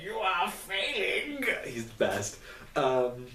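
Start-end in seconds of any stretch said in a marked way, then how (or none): 0.0-1.4 slow, theatrical villain voice; villain impression. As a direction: stretch it out like a hammy film villain. Quotes film villain as impression.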